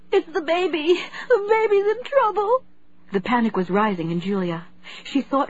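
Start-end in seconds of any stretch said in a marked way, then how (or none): 0.1-2.6 high, panicked cry. A narrator's reading. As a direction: plead in terror. Mother in pain and panic.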